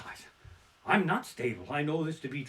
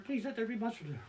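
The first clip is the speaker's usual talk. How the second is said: softer, higher desperate prayer; hushed anxious plea, rising in pitch; higher pleading whisper; 6 semitones higher, 8 dB quieter, faster than usual. Retold or imitated. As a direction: plead more urgently under the breath. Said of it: "I'm standing there going".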